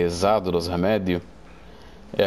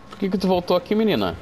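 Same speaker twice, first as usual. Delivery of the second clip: mock scolding; 9 semitones higher than usual. Scolding the killer character.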